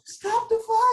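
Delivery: very high, loud mock shout; piercing high comic plea; Rocky movie cry. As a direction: yell it from ringside, hammed up. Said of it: "when they say".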